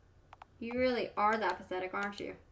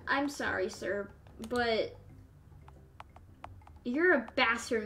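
usual speaking voice

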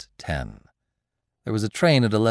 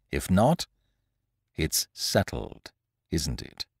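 low, soft, measured threat; cold voice; 4 semitones lower, 4 dB quieter, slower than usual. A narrator's reading. Lupin warns Beautrelet to keep out, cold menace. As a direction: let it drop quietly and coldly. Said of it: "in a cold voice".

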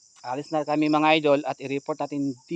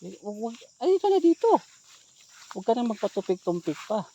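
high, hurried urging; urgent; 6 semitones higher, 4 dB louder than usual. Acted. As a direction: hurry her up, half-panicked. Hurrying the woman along.

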